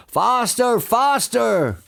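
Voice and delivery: high, frenzied urging; shouting. A narrator's reading. Boggis hops about urging the diggers, shouting. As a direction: chant it high like a madman driving others on. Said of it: "hopping about like a dervish and shouting".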